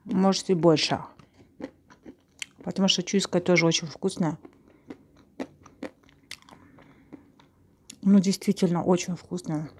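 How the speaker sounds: chewing between words; talking while chewing. Real, not acted. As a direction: mouth partly full. Seen 0: Bit the bar at -5; cheeks and lips move as if chewing while talking.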